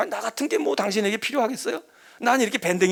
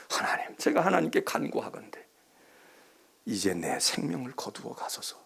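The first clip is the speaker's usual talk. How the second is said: sulky prayer; 6 semitones lower, 6 dB quieter than usual. Acted. Jonah's prayer acted out.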